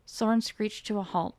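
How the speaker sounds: usual speaking voice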